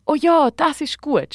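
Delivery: overjoyed storybook character; beaming delight. A narrator's reading. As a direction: cheer the idea on delightedly. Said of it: "strahlt der Gabriel".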